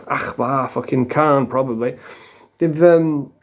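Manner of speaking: ordinary talk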